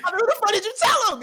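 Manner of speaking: loud, high furious yell at his friend; retold angry shout. At his homie who was asked about robbing him, a furious high shout. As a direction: demand it, outraged and shouting.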